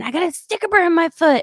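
put-on high, whining child complaint; mock child whine; whiny kid. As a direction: play the kid crying about his foot. Kids' complaint voiced by mom.

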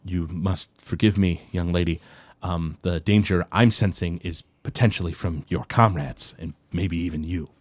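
lightly voiced dwarf, low and soft; a hint of a weary, hurt old dwarf; quiet, subdued dwarf speaking from the doorway; Goldbeard.

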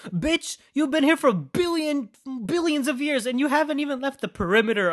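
mock him in disbelief; high-pitched scoffing rant; exasperated mockery. Real, not acted. Mocking Pennywise in rant.

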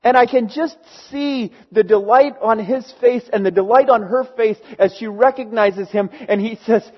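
normal talking voice

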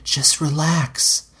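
slow seductive reassurance; soothing. A narrator's reading. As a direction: draw it out to calm him. Lara soothing Dunk slowly.

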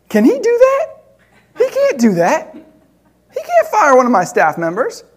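high-pitched incredulous outburst; worked-up, can-he-really-do-that indignation; indignant disbelief. Retold or imitated. Indignant phone call about judge.

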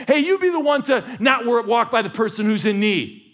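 high, loud, driving sermon voice; rising pulpit fervor; preaching at full force. Acted. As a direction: preach it out to the back row.

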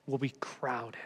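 soft, low, slowed final plea; whispered appeal. Acted. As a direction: breathe the last words to the room. Sermon close.

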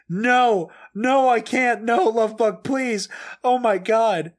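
high, loud mock anguish; desperate pleading. Fan begs Love bug to stop, hammed-up desperation.

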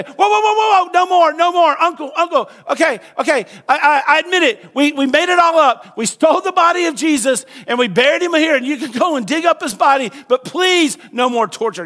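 high, loud pleading cry of a tortured man; a hammed-up plea for mercy; begging under torture. Tortured disciple begging, acted.

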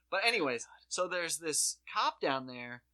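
ordinary talk

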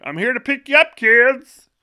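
goofy creepy van driver. Pretend. A man in a beat-up van calling the kids, high silly voice. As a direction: announce it like a cheerful weirdo. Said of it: "and been like".

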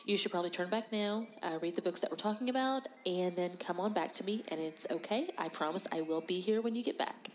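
ordinary delivery

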